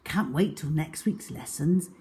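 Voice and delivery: usual speaking voice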